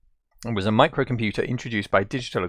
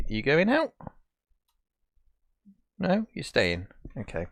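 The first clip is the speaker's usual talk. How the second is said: pet talk; 5 semitones higher, 4 dB quieter than usual. Real, not acted. Talking to the dog.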